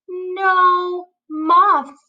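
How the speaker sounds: high, drawn-out "no"; high-pitched character reply for children; moth's reply. Acted. Moth's reply acted in the story.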